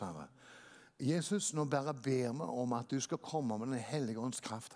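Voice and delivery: soft, low, murmured prayer. Acted. Pastor praying over the congregation, soft and devout. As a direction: pray quietly and devoutly.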